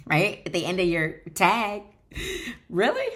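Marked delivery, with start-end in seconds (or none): 2.7-3.1 mock disbelief. Mock disbelief at Facebook weight.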